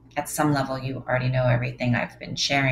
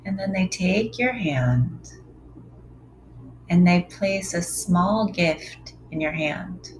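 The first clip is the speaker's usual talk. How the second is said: guided meditation. Acted. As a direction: lead them gently into stillness.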